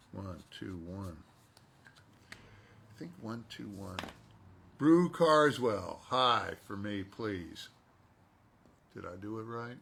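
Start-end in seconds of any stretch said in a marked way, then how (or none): 4.8-7.7 slow, loud in-character line. Acted. Actor reading a fan's requested line, slow and loud. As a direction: deliver it slowly as the character.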